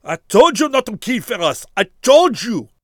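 high, loud furious yell; furious shout. Acted. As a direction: scream it in desperate rage. Barreau shouts at the trees.

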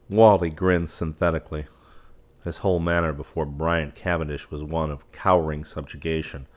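ordinary delivery

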